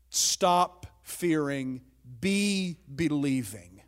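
each word drawn out slowly; slow and deliberate. Shown as a demonstration. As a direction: lay out the phrase word by word, slowly. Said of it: "In the original language".

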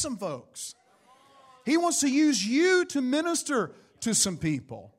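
fervent preaching. Real, not acted. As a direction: exhort the congregation with conviction. Preaches with force to congregation.

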